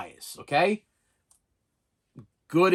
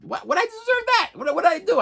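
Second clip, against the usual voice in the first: mock outrage; 13 semitones higher, 4 dB louder than usual. Indignant mock outrage at friend.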